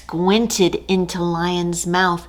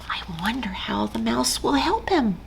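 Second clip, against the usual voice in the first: put-on hushed whisper to the children; storyteller's secret whisper; whisper aside; 3 semitones higher, 6 dB quieter, much faster than usual. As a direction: lean in and whisper.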